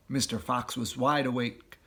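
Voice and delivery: usual speaking voice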